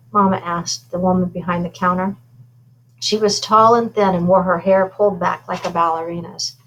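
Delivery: usual speaking voice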